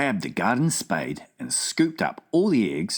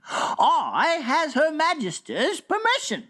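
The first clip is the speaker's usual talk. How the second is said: higher gleeful giant cry; cried out. Acted. Giant given permission to whizpop, gleeful. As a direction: crow with glee. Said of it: "cried the B F G".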